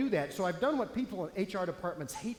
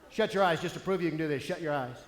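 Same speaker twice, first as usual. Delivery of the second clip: ordinary talk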